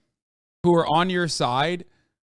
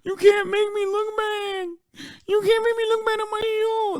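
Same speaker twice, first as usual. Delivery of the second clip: much higher, crying whine; mock crybaby whine. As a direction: whimper it like a hurt child. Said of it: "what I hear is".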